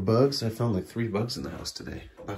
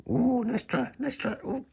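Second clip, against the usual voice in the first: teasing pet talk; slower than usual. Real, not acted.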